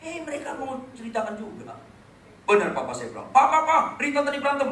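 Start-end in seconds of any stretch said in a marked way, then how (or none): 3.3-4.7 higher, louder sibling calling out to dad; tattling call. Retold or imitated. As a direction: call to dad, eager to tell on her. (kakak memanggil papa dengan keras dan bersemangat)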